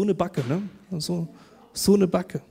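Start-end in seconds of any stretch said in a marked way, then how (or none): none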